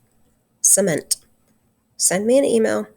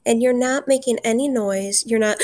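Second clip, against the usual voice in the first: ordinary talk, nothing marked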